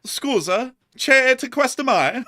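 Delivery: loud, high, playful Italian; hammed-up Italian. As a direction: put on an excitable Italian voice. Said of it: "I just spoke Italian right there".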